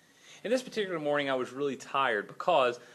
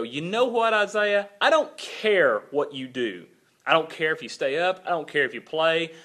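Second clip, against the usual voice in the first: fed-up dad laying down the law, louder; raised, emphatic scolding; retold angry outburst; 3 semitones higher, 4 dB louder than usual. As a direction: re-enact a furious parent at the end of his rope. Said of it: "I'm getting really mad".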